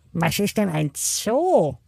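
high, slow cartoon penguin voice; squawky bouncy penguin. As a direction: play a cheerful little penguin, very high. Penguin asks Lennart.

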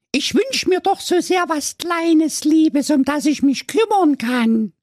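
put-on high, pleading girl voice; whiny teenage daughter. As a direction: play the spoiled fairy-tale daughter wishing aloud.

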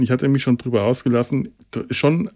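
ordinary delivery

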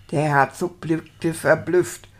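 usual speaking voice

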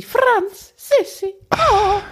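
hohe, gespielte Sissi-Stimme; hoch und schmachtend nach dem Geliebten rufen; schwärmende Sissi. Acted. Plays Sissi swooning at Franz. (hingebungsvoll gespielter Sissi-Seufzer)

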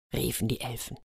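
ordinary delivery

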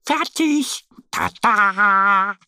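triumphant call. A narrator's reading. Annie shows Mo the finished backpack, triumphant high Tada. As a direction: announce it like a proud magician.

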